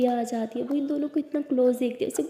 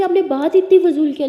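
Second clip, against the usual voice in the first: louder and higher, indignant; angry outburst; 5 semitones higher, 9 dB louder than usual. Acted. Lakshmi confronts Lily about her remark, loud and indignant. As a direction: hit back, offended. Argument continues.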